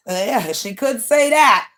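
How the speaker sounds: ordinary talk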